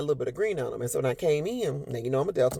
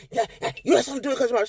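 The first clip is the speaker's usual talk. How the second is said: fast, raised scolding; mock angry dad; 9 semitones higher, much faster than usual. Father scolding crying daughter, fast and mocking. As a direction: rattle it off at the child.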